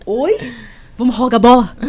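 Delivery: loud, baffled repeat of "Vamos rogar bola?" with the words pulled apart; husband repeating the teen's odd words, confused; puzzled echo. Retold or imitated. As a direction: echo "Vamos rogar bola?" back as if you can't make sense of it. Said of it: "Aí ele".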